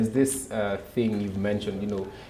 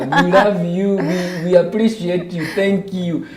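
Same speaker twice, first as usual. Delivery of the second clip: playful put-on Ugandan accent, higher and louder; 5 semitones higher, 6 dB louder than usual. Shouting out to Ugandan viewers in a mock Ugandan accent. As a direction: greet them in a hammed-up Ugandan accent. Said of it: "let us know if [name] has gotten that accent right".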